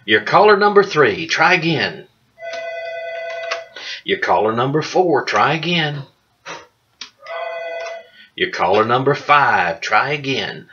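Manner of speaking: upbeat radio announcer voice; radio contest promo. Pretend. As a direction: announce each caller cheerfully. Mock caller countdown on air.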